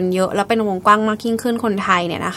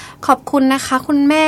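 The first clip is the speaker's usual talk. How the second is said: imitated trembling daughter, higher and slow; mock quavering thank-you, pitched up; 5 semitones higher than usual. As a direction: retell a shaky farewell, drawn out. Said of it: "เราพูดเสียงสั่นเครือมาก".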